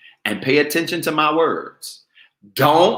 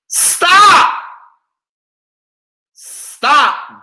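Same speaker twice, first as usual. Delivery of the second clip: shouted stop; 10 dB louder than usual. Real, not acted. Preacher cutting off laughter.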